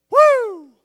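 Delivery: sudden loud high-pitched whoop. Real, not acted. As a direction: let out a piercing preacher's woo. Preacher's exclamation.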